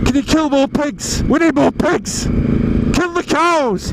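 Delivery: hammed-up hoarder voice; mock panicking shoppers. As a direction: play a greedy shopper ordering slaughter. Voicing panicking farm-shop shoppers.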